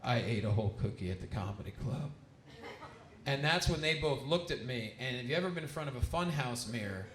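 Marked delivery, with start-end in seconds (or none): 0.0-2.1 low, subdued admission; sheepish confession. Retold or imitated. Comic confesses to the twins about the whole cookie, sheepish and low. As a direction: drop the voice much lower, owning up.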